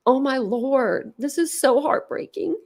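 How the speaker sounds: words pushed out through real tears; real crying. Real, not acted. Streamer crying on a live over the waived trial, tearful. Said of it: "I just cried on a live".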